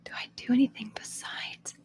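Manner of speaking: ordinary talk, nothing marked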